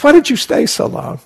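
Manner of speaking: very high, loud imitation of the teacher; mock reproachful question. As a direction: repeat the demand in exaggerated high pitch.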